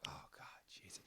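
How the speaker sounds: whispered under the breath, fed up; exasperated whisper. Retold or imitated. In line behind a big order, a fed-up whisper. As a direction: sigh it out unvoiced in despair.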